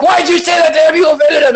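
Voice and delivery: very high, frantic outrage; hammed-up hysterical accuser, pitch way up; mock yelling. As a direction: shriller repeat of an overblown scolding. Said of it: "'cause you're yelling at me".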